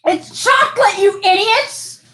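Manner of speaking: high playful yell at friends; mock exasperated shout. Host revealing the wrong guess.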